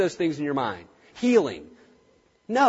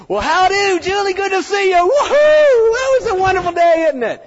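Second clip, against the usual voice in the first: much higher and louder, showy cheer; over-the-top gushing greeter; 9 dB louder than usual. Shown as a demonstration. Vet greeting a client with loud, showy cheer. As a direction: ham up a loud, fake-friendly hello. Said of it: "I get in there and go".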